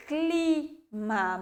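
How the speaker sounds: "clima" with C and L run together; careful cluster. Shown as a demonstration. Teacher showing how she says the word. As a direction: glide C straight into L. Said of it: "eu falo".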